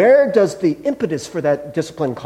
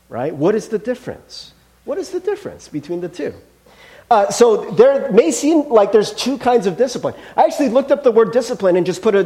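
ordinary talk, nothing marked

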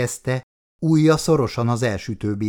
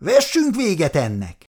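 shouted challenge; 7 semitones higher than usual. A narrator's reading. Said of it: "ordította".

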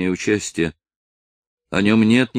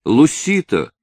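high, alarmed exclamation; exclaiming; 7 semitones higher than usual. A narrator's reading. Dolores to the exhausted girl, alarmed exclamation. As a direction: cry out the question, pitch raised. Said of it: "воскликнула Долорес".

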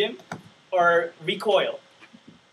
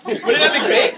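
laughing; 5 semitones higher, 5 dB louder than usual. Real, not acted. Laughing at his own wish.

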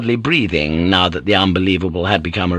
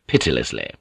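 usual speaking voice

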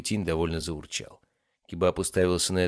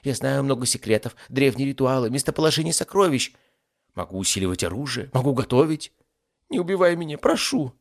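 high, anxious little ifrit voice; pleading demon; 7 semitones higher, 5 dB louder than usual. Acted. Demon offers his services to avoid death, pleading. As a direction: plead higher and higher for your life.

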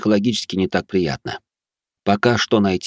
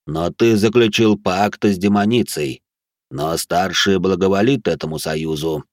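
heavy otherworldly voice; 2 semitones higher, 3 dB louder than usual. Acted. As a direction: press each word down with power. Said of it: "эти слова казались тяжёлыми". (тяжёлый потусторонний голос стража)

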